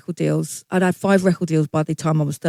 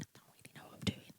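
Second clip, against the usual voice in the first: unvoiced whisper of private doubt; fast, breathless whisper to herself; whispered thought; 29 dB quieter, faster than usual. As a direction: whisper it like a secret worry.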